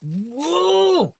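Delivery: very high, loud scream of disbelief; real exasperated yell. Real, not acted. Reacting to the reparations argument with an outraged scream. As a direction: let out a sudden frustrated shriek. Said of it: "Makes you wanna scream".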